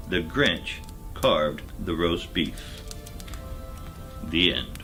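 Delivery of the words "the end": ordinary delivery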